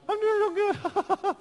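put-on very high, slow girly squeak through a laugh; little girly voice. Preacher laughs while mimicking a patient's high girly voice. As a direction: squeak it slowly, giggling like a little girl. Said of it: "got that little girly voice".

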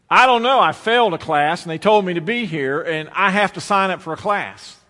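put-on flustered kid loudly explaining himself to the clerk; loud blurting. Young self blurting at registrar.

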